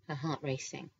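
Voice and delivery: usual speaking voice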